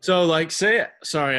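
breathless, gasping between words; out of breath. Real, not acted. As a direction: talk while still catching your breath. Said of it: "I'm kinda out of breath".

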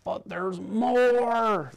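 slow, high doctor's warning. A narrator's reading. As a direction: draw it out like a solemn doctor. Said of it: "the doctor said".

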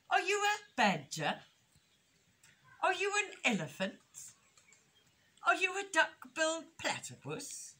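high, curious little rabbit voice; innocent young rabbit. A narrator's reading. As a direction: ask with naive, singsong curiosity.

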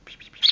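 soft imitated chick peeps; peeping calls. Shown as a demonstration. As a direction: peep like the bird. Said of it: "wenn ich piep, piep mache".

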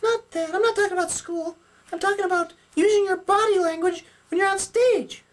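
very high puppet voice; Sally puppet, correcting. Acted. Sally corrects Jeremy about cheating out, correcting puppet voice. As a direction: set them straight in a squeak.

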